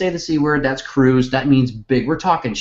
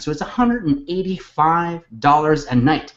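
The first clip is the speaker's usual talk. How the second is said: ordinary delivery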